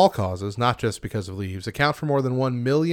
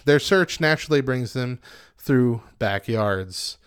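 usual speaking voice

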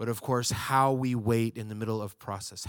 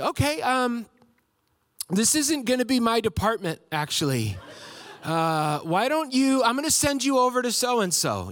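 higher, a bit sheepish mock agent; passing him along with a chipper voice; chirpy support agent; 9 semitones higher, 4 dB louder than usual. Agent transferring the caller elsewhere, mock chipper. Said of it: "more impressions of DirecTV people".

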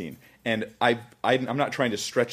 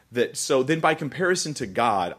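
normal talking voice

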